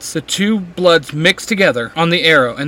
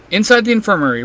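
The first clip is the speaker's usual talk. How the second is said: ordinary delivery, nothing marked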